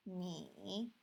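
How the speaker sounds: "ni" dipping, then rising; drawn-out falling-rising "ni"; third tone. Shown as a demonstration. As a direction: take "ni" down into a valley and back. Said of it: "one, two, three".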